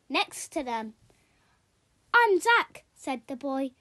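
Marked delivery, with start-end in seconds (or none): 2.1-2.8 high, loud friendly hello; a boy eagerly greeting a new friend. Acted. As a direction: bounce in with a bright introduction. Boy introduces himself to Pip.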